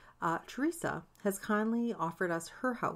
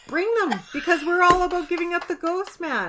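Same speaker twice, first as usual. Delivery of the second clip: high and loud, playful spooky hype; excited; 10 semitones higher, 10 dB louder than usual. Pretend. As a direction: call out the dead with glee. Joking about summoning dead relatives.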